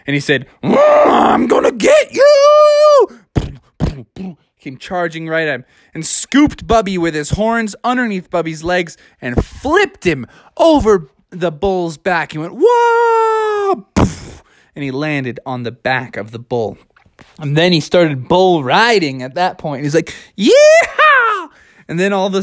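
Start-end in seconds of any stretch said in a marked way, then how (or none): 0.6-3.1 very high, loud, drawn-out battle cry; roaring bull threat. Acted. Bull threatens before charging. 12.6-13.9 high, long yell; flung-in-the-air whoa. Acted. Bear flipped through the air. 20.4-21.5 high, loud cowboy whoop; rodeo yeehaw. Acted. Bear starts riding the bull.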